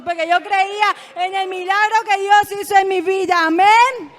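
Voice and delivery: loud testimony building to a high amen; clamor de fe. Real, not acted. Testimonio que culmina en amén. (testimonio enfático que culmina en amén)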